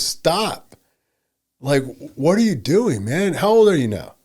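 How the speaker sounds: exasperated counselor. Retold or imitated. Friend cutting off a counseling client, exasperated. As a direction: cut him off in exasperation. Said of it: "and he goes".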